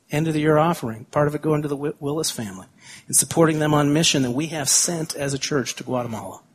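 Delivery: usual speaking voice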